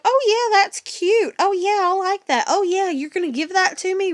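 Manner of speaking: high, loud, gushing mock girl voice; gushing friend. Mom mimicking her daughter's greedy friend, high gushing mock voice. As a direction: ham up an eager kid who wants the shirt. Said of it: "her friend's gonna be like".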